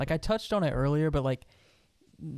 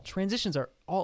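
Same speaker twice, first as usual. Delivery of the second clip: ordinary delivery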